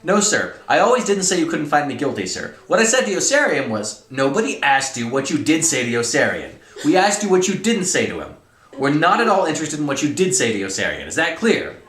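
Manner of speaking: loud, high-pitched heated interrogation; dramatic story reading. Acted. Colonel grilling cadets at trial, loud and exasperated. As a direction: act out a furious colonel and protesting cadets at full volume.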